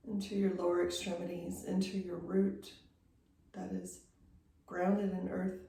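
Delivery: unhurried yoga-teacher meditation voice, long pauses between phrases; guided meditation. Real, not acted. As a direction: guide them gently into stillness, leaving space after each phrase. Leads guided breathing meditation.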